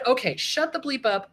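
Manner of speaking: usual speaking voice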